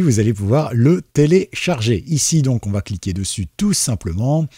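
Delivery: ordinary talk, nothing marked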